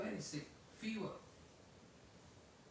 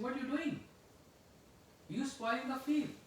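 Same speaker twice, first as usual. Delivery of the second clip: higher, louder scolding; angry scolding; 7 semitones higher, 4 dB louder than usual. Retold or imitated. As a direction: tell him off for ruining the field. Wife catches him inviting birds.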